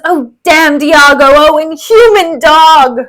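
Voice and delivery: loud, slow cursing; dying curse. Acted. Stabbed Rodrigo cursing Iago, loud and slow. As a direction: spit the curse out.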